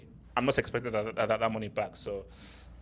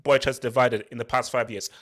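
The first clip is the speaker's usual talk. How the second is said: normal talking voice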